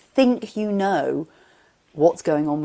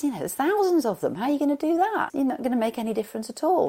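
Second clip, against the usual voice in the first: higher-pitched sceptical passer-by; mock incredulous challenge; 8 semitones higher, faster than usual. Passer-by questioning the starfish rescuer, mildly incredulous. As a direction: protest in a higher, disbelieving voice.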